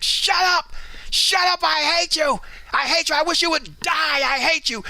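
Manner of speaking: high, whiny mock screaming brat; bratty kid tantrum.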